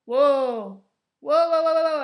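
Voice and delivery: loud, high startled whoas; shocked whoa. Retold or imitated. Retelling the stabbing scene with loud whoas. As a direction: gasp out the whoas. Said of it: "And it's just like".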